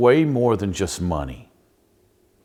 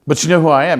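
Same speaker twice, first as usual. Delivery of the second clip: high, loud, indignant; a distraught girl loudly demands to be recognised; 8 semitones higher, 8 dB louder, faster than usual. Retold or imitated. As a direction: she insists, raising her voice. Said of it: "And she starts getting loud".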